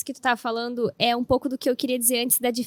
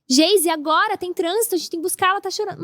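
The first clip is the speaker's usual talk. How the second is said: anxious pleading; 6 semitones higher, 4 dB louder than usual. Retold or imitated. Retelling her panic to husband.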